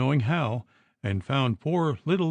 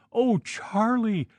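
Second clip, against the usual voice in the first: anguished plea; 7 semitones higher than usual. A narrator's reading. Wife pleading with husband's ghost.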